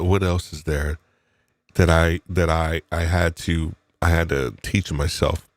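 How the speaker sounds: normal talking voice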